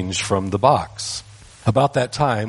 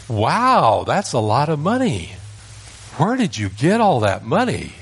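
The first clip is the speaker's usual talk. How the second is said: amazed aunt; 5 semitones higher than usual. Retold or imitated. Aunt marvelling at little boy's money, high and amazed. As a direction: gush over the child's pennies.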